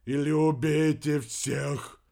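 loud, slow, drawn-out moan. A narrator's reading. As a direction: groan it out in suffering, stretched. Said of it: "вновь простонал Вернон".

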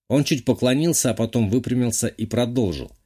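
ordinary delivery

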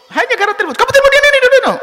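a preacher hamming up a nagging wife; shrill jealous wife. Retold or imitated. Preacher imitating a wife accusing her husband, high and loud.

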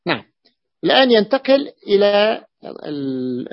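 ordinary talk, nothing marked

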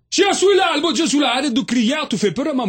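crié fort et aigu, de loin; réponse criée. Acted. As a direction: crie pour qu'on t'entende de l'autre bout. (crié haut et fort, à tue-tête)

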